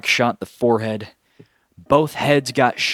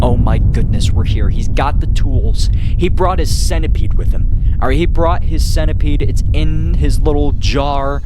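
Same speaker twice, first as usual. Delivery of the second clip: loud, slow, booming hype; hyped announcer; 7 dB louder than usual. Real, not acted. Vlogger hyping his audience for the big scene, loud and slow. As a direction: build it up like a ring announcer.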